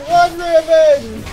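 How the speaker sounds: urgent shout. Real, not acted. Urging teammate to flee.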